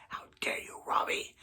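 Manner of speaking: unvoiced whisper, soft and accusing; whispered how dare you. Fan whispering an accusation at Robbie. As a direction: hiss it at Robbie like a secret accusation.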